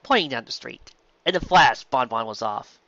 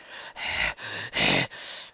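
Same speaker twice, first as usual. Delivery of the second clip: deep sigh; 4 dB quieter than usual. Acted. Deep rumbling exhale.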